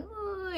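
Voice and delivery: high soft sob; sister whimper. Retold or imitated. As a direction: cry along with her. Sister crying along.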